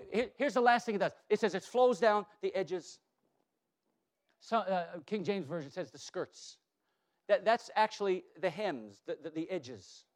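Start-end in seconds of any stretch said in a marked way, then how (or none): none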